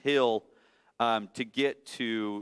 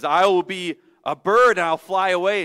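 higher, louder, fast defiant little bunny; the runaway child daring his mother; 5 semitones higher, 8 dB louder than usual. Acted. Bunny voice in story.

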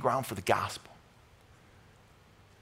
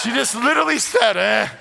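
laughing; 6 semitones higher, 7 dB louder than usual. Real, not acted. Congregation member answering, laughing.

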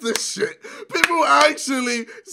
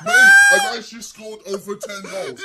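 loud, laughing outburst; 1 laughing protest; 7 dB louder than usual. Real, not acted. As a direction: shout your defence through laughter. Defending himself, laughing.

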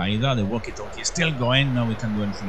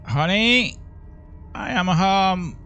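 higher, slowed sing-song greeting; mock homecoming; 6 semitones higher, slower than usual. Gamer joking to his viewers in the scary house, a mock homecoming. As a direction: ham up the cheery spouse, then the joke. Role-playing coming home, joking.

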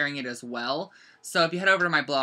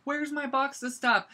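mock-frantic, higher-pitched question; retold frantic search cry, higher; 10 semitones higher than usual. Crafter searching everywhere for a lost box, frantic. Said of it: "I was frantically looking around".

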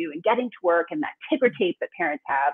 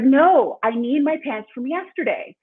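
put-on loud stubborn child's no, pitched up; imitating a defiant toddler; 6 semitones higher, 5 dB louder, slower than usual. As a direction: play the three-year-old digging in his heels. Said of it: "And he's like".

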